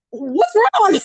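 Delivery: concerned supervisor. Retold or imitated. Quoting her supervisor on the phone, a high concerned voice. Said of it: "She's like".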